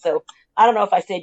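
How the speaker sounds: ordinary delivery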